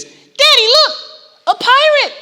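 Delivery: put-on high, loud toddler voice; little girl voice. A toddler excitedly tells her daddy in a high child voice. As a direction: pipe it up like a thrilled three-year-old.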